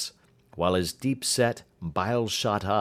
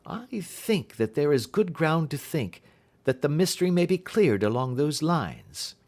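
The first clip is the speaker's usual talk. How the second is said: a lightly raised, brisk Holmes; 6 semitones higher than usual. A narrator's reading. Holmes asking Watson his view, lightly voiced. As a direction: lift the voice into Holmes, curious.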